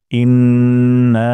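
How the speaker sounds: ইন্না with the না held one alif; slow recitation. Shown as a demonstration. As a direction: stretch the না of ইন্না. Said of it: "নাতে একালির টান দিব". (ইন্না, না এক আলিফ টেনে)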